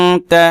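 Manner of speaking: আনতা with a long hum on the n; nasal ghunna. Shown as a demonstration. Teacher repeating the ikhfa example, held nasal ghunna. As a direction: hold the n of আনতা in the nose, then তা. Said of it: "এইভাবে আমি গুণনা করেছি এক আলিফ".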